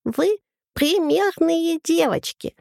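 high, slow, squeaky lisping governess; squeaky burring voice. Retold or imitated. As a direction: mimic a fussy governess, high and slow, rolling R badly. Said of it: "картавя произнесла писклявым голоском".

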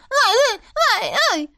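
high sharp yelping barks; barking yelps. A narrator's reading. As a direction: yap it like a small dog. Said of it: "залаяла".

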